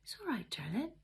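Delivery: soft, whispered comfort; soothing. A narrator's reading. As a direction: rock him with the words. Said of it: "rocked him soothingly".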